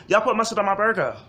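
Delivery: high, loud, fast whiny customer complaint; angry customer voice. Retold or imitated. Customer yelling at the cashier about mustard, high and whiny. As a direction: rant like an entitled diner over a burger.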